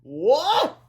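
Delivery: high, loud startled what; jolted by surprise; shocked. A narrator's reading. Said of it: "said Harold".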